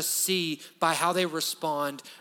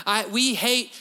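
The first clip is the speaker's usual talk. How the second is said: ordinary talk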